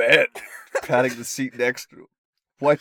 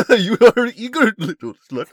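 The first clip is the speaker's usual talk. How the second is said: higher, louder mock seducer; hammed-up hero; 10 semitones higher, 10 dB louder than usual. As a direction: ham up the lover's taunt. Reading the hero's dirty talk.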